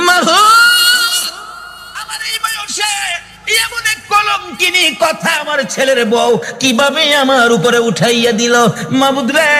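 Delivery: a high wailing cry, then pleading through tears; mother wails to God about the daughter-in-law's slander, crying; crying out in grief. A narrator's reading. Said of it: "একটা চিৎকার মেরে চোখের পানি ছেড়ে দিলেন".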